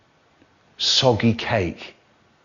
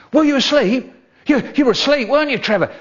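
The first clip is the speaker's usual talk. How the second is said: put-on excited kids; 12 semitones higher, 3 dB louder, faster than usual. Kids crowding round excited.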